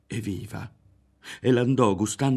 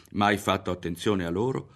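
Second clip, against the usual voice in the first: plain reading voice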